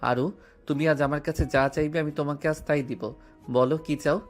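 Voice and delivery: usual speaking voice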